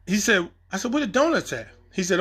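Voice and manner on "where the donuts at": high, playful voice calling for donuts; playful ask. Retold or imitated. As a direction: call out to the crafty guy like a cheeky cop. Repeating the donut question.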